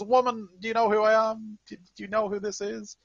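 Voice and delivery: high, loud, indignant demand; outraged character outburst, easing down towards the end; [name]. Acted. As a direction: play a blustering soldier pulling rank, high-pitched and loud. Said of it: "[name] is just slamming on the thing and says".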